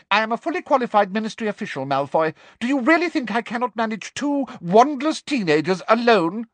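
fast, high, cutting; Umbridge's fast, sharp rebuke. A narrator's reading. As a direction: cut him down briskly. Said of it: "asked Umbridge sharply".

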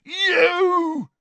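put-on furious drunken roar; bellowed. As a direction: roar it at the intruder. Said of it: "he bellowed".

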